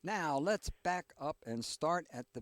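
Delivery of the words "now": ordinary talk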